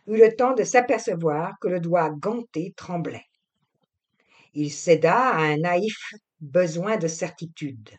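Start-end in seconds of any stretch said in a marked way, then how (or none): none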